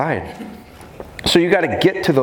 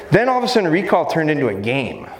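normal talking voice

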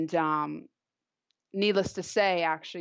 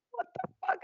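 very high, hushed mock squeal; shocked girlfriend; 5 dB quieter than usual. Girlfriend watching her go into the police car, a high shocked squeal. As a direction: imitate a girlfriend gasping in shock.

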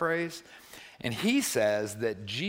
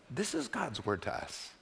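normal talking voice

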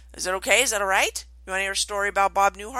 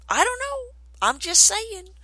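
much higher, teasing shrug; coy sing-song; 12 semitones higher, 4 dB louder than usual. Real, not acted. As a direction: play innocent, voice way up. Playful coy aside.

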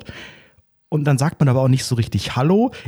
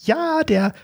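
put-on high, slow old-lady voice; hammed-up granny excuse; old woman; 10 semitones higher than usual. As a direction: drag it out like a flustered granny.

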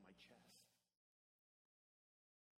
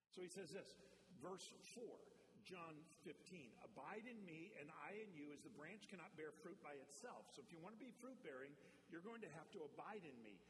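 loud, higher preacher voice driving the verse home; full-force preaching; 4 semitones higher, 4 dB louder than usual. Acted. Pastor drives John fifteen home to the church, loud preaching. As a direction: preach it hard to the congregation.

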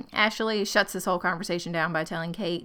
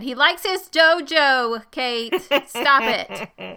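dismissive retort; 4 semitones higher, 5 dB louder, slower than usual. Retold or imitated. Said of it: "And I said".

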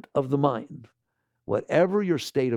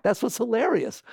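normal talking voice

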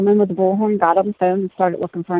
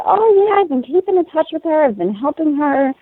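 excited high voice; 8 semitones higher than usual. Retold or imitated. Mary quoted, excited news.

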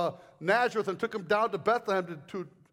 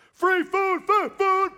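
high, loud, gleeful call of free food; excited; 10 semitones higher, 6 dB louder, much slower than usual. Acted. Preacher hyping free food to the youth group, loud and gleeful. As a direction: cry it out like a bargain hunter.

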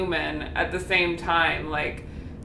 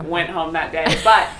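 usual speaking voice

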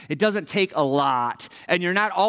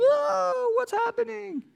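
much higher, dazed mock confusion; mock bewildered whoa; slower than usual. Pastor imitating confused congregants during the mask season, mock bewilderment. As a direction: play the dizzy churchgoer, high and lost. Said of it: "just kind of like".

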